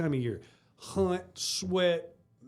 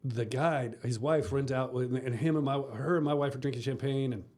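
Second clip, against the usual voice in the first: ordinary delivery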